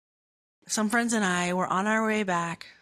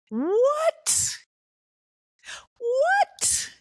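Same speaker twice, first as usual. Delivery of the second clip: squeaky high shocked What; mock disbelief; 3 dB louder than usual. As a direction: gasp out What like you can't believe it. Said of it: "mock disbelief at another [name]".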